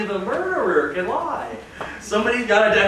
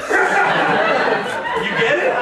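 laughing slow question; 8 semitones higher, much slower than usual. Acted. Demonstrating the squeeze, laughing.

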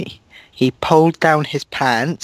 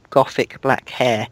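normal talking voice

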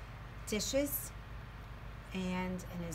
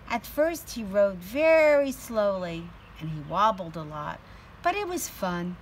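lively, louder storybook voice; teacher reading a picture book aloud to her class; reading to children; 9 dB louder than usual. A narrator's reading. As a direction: read it out with energy for little kids.

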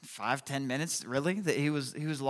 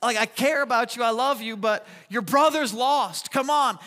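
louder, urgent dad pleading; exasperated dad; 5 semitones higher, 4 dB louder than usual. Retold or imitated. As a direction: hurry them along, fed up but loving. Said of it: "And I've been like".

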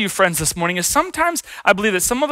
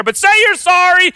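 high, insistent parental order; scolding parent; 12 semitones higher, 4 dB louder than usual. Retold or imitated. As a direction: order a child to apologize, pitched up. Parent ordering child to apologize.

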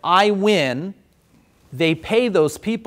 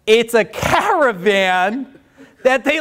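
normal talking voice

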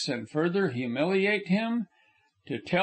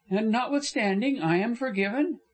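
a higher, earnest young woman's voice, only lightly put on; lightly voiced young woman; 7 semitones higher than usual. Frona insisting to Corliss she behaved badly, lightly raised young woman's voice. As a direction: lift the voice a little for the heroine, remorseful and insistent.

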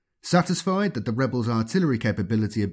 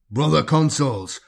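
louder, slow summons; battle command; 4 semitones higher, 4 dB louder than usual. Acted. Librarian ordering marines mid-battle, commanding call.

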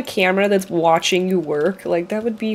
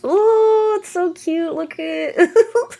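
high, slow cooing over something adorable; gushing; 9 semitones higher, slower than usual. Real, not acted. Streamer cooing at the cute game, high and slow. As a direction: melt over how cute it is.

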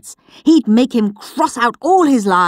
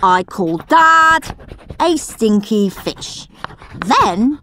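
put-on gleeful cheeky boy; 4 semitones higher, slower than usual. Henry's doctored diary read as a slow, gleeful cheeky boy. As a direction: savour every naughty word.

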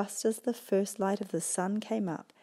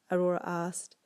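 usual speaking voice

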